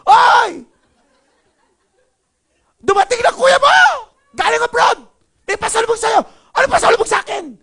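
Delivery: high, very loud shouting; angry family talk. Acted. Angry family chatting about a brother home from abroad, shouting angrily. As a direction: bellow happy news as if furious. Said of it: "Tapos yung pamilyang galit na yun, nag-uusap".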